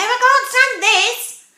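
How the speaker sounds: loud, high, slow storybook complaint; exasperated Mister Bear. Acted. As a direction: wail it slowly, utterly fed up. Fed-up bear exclaims in story.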